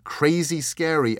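plain reading voice

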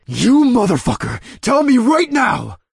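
high, loud, slow furious yell; enraged shout. A narrator's reading. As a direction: roar it in pure rage. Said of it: "red-hot rage boiled up".

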